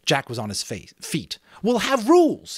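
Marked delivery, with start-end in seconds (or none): 1.6-2.6 much higher, louder eager shout; excited cry. Acted. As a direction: cry it out thrilled by the idea. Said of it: "he cried excitedly".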